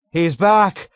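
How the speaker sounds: loud, high terrified echo; automatic terror. A narrator's reading. Delirious patient echoing the alarm, in loud terror. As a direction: cry it back in panic. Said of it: "echoed in automatic terror".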